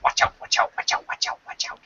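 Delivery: little unvoiced parrot squawk fading away; a tiny bird's urgent chatter dying off. Parrot in the rolling ball, fading squawked warning. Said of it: "all you heard from inside the ball was".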